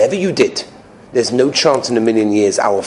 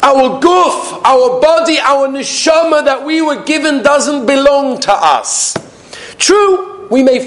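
high, slow sermon declamation; impassioned preaching; 14 semitones higher, 3 dB louder, slower than usual. Real, not acted. Rabbi teaching that body and soul are not ours, impassioned preaching. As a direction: declaim it slowly with rising force.

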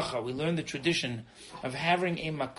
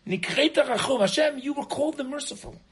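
higher, louder anguished plea; pleading with God; 6 semitones higher, 4 dB louder than usual. Retold or imitated. Noach crying out to God after the flood, pleading. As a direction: cry out to God for mercy. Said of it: "and he says".

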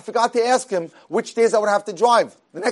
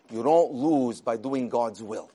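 solemn reverent lesson; 8 semitones lower, 7 dB quieter, slower than usual. Retold or imitated. Quotes the passenger's amazing lesson.

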